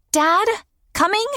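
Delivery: put-on high child calling; girl calling out. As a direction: call from another room like a kid. Girl calling from other room.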